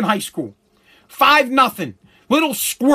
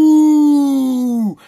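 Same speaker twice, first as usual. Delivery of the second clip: crowd gasp; 3 semitones higher, 4 dB louder than usual. Retold or imitated. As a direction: imitate a whole hallway going ooh at once. Hallway crowd reacts to insult.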